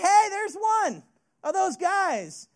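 high, slow mocking girl voice pointing him out; a young girl calling out across the campfire, teasing; junior high girl. Junior high girl to Peter at the campfire, high teasing call.

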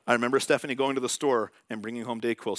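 ordinary talk

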